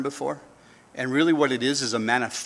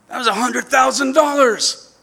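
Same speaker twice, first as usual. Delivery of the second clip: mock whine; 10 semitones higher, 8 dB louder than usual. Whining about lost money.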